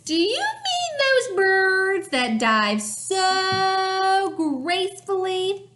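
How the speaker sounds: hammed-up Tacky. A narrator's reading. Reader as Tacky asking the hunters about divers, hammed-up question. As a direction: draw out the words in grand mock wonder.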